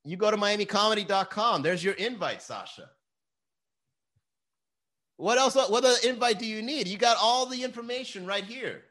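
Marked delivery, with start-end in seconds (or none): 5.2-8.8 high-pitched, incredulous scolding; worked-up, high-pitched exasperation; exasperated. Real, not acted. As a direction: badger them for missing the obvious. Scolds viewer asking for invite.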